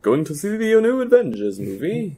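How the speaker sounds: high mock-aristocrat ordering Jeeves; posh gentleman voice. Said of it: "Who, who says that?".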